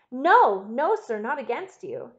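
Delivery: loud, high cry of denial, easing off; urgent protest. A narrator's reading. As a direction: burst in with a pleading denial. Wife protests from the door.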